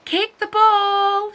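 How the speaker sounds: slow, high, loud football yell; shout. A narrator's reading. Benz yelling to his teammate in the game, slow loud shout. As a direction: bellow it across the pitch. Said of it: "shouts Benz".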